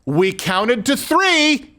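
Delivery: high, slow, sing-song warning; drawn-out playground taunt; dragging out a teasing count to three; mock friendly warning. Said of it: "That was us saying, like".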